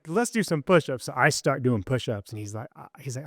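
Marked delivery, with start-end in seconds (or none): 0.1-1.1 high, upbeat urging; rally a reluctant buddy, pitched up; retold rallying call. Urging a detox roommate into push-ups, rallying. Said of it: "trying to rally the troops".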